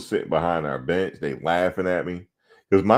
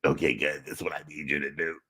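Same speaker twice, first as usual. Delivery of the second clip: soft, strained lost-voice impression; imitating a lost voice; 8 dB quieter, faster than usual. Coach addressing team at halftime, imitated lost voice. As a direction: talk like your voice is shot. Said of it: "He lost his voice".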